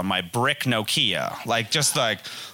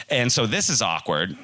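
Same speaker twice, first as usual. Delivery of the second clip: ordinary talk, nothing marked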